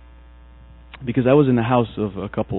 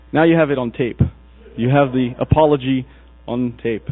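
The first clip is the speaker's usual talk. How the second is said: usual speaking voice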